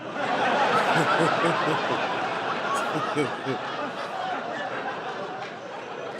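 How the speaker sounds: long laugh with no voice; real laugh. Real, not acted. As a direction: keep laughing at the memory. Laughs at his own joke.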